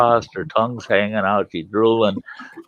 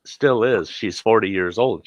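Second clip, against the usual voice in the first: ordinary delivery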